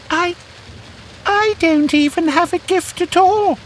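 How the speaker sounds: very high, timid mouse voice; little mouse muttering. Acted. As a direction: mumble it like a shy little mouse. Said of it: "the little mouse muttered softly".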